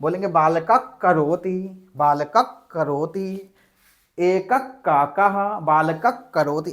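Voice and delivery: slow: बालकः करोति said "baalakak karoti" with a doubled k; teacher demo of बालकः करोति; demonstrating sandhi pronunciation. Shown as a demonstration. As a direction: give बालकः a half क before करोति. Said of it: "बोलेंगे कैसे का".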